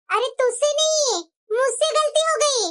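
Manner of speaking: ordinary talk, nothing marked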